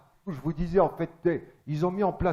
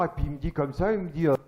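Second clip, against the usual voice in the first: usual speaking voice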